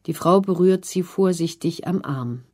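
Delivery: ordinary delivery, nothing marked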